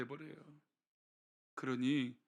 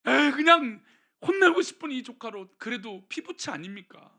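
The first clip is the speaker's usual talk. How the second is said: louder, higher, pleading for the nephew; Abraham's pleading heart; 6 semitones higher, 5 dB louder than usual. Retold or imitated. Abraham pleading with God for his nephew Lot, earnest. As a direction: plead with God like a worried uncle. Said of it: "아브라함의 속마음은 뭘까요?".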